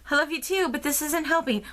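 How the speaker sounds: frantic but loving, push for the real news; exasperated freaking out. Retold or imitated. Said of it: "freaking out".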